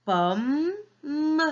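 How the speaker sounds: "Pomme, m" broken in two, rising first beat, then a held high "m"; split into syllables. Shown as a demonstration. Teacher shows children the two syllables, syllable by syllable. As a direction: sound out "Pomme" in two beats, ending on a separate "m". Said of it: "Il y a deux syllabes".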